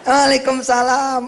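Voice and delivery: high, slow, drawn-out reply; answering greeting. Acted. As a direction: greet the returning son warmly. Parent answers slowly. (jawaban salam panjang dan lambat)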